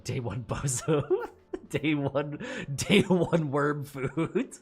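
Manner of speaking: loud mocking taunt through laughter; real laughter, slow drawn-out jeer; laughing taunt. Real, not acted. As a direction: laugh at yourself as worm food. Laughing taunt at own death.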